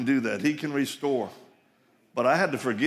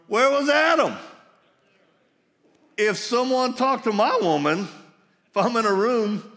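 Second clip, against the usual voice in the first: much higher and louder, demanding where Adam was; rising indignant shout; 10 semitones higher, 5 dB louder than usual. Real, not acted. Preacher calling out Adam's absence, indignant shout. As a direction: let the outrage climb to a shout.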